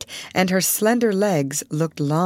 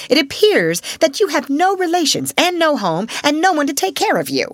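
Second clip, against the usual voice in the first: much higher and louder mocking scorn; temper rising; 10 semitones higher, 5 dB louder than usual. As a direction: rub in the child's ruin angrily. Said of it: "her temper rising".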